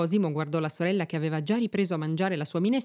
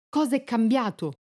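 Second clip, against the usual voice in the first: higher-pitched startled question; narrated outcry from Cosimo; cried out; 6 semitones higher, 4 dB louder than usual. A narrator's reading. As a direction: call it out, short of a full shout. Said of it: "gridò Cosimo".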